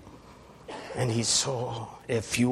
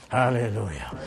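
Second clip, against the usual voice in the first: normal talking voice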